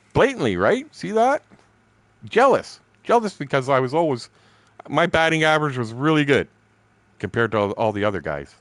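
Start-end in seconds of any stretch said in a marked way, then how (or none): 0.1-5.8 high, louder exclamations; indignant emphasis. Real, not acted. Telling about the jealous coworker, high indignant exclamations. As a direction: drive home the betrayal.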